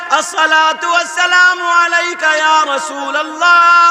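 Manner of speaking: high, loud, slow declaimed salutation; full-force preaching. Acted. (ऊँची, धीमी, ज़ोरदार तक़रीर)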